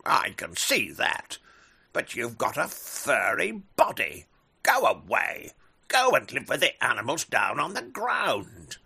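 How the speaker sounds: loud, stern eagle ordering away; angry eagle shout. Acted. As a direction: drive him off with a shout.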